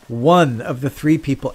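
ordinary delivery

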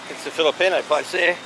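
usual speaking voice

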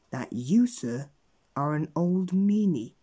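emphatic, measured telling-off; pointed accusation. A narrator's reading. Wizard tells Mister Mean what he is, accusingly. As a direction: land the final words slow and loud.

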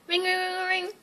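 high, soft phone ring; imitated ringing. Phone rings while the pizza cooks, imitated ringing. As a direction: run the rings together on one high note.

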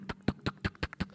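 whispered footstep clicks. Retold or imitated. Parents walking away, whispered footstep sounds. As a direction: tap out leaving footsteps. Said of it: "sambil dek".

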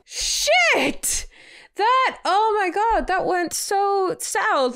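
high, loud, drawn-out dismay; shocked outburst. Real, not acted. Viewer watches a play fight go wrong, shocked outburst. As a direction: exclaim in real shock, stretching the words.

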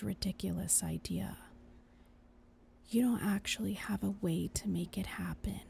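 soft unvoiced whisper; a hushed conscience pleading. Angel whispering throughout.

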